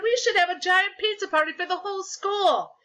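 put-on high, loud kid's pitch; shouted. A narrator's reading. Ryan proposes a pizza party, shouted. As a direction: call it out eagerly to the class. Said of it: "Ryan shouted".

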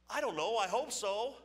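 a scared rookie admitting he doesn't know; nervous rookie. Retold or imitated. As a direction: blurt it out like a scared kid. Nervous rookie answers coach.

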